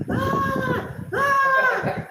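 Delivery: high, loud shriek of panic; panicked scream. Retold or imitated. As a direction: scream as the bug crawls out. Screaming at creature in shoe.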